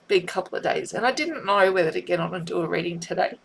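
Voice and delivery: ordinary talk, nothing marked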